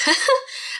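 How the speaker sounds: quick high-pitched giggle; short bright giggle. Real, not acted. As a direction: laugh at yourself, embarrassed. Laughs at her own rambling.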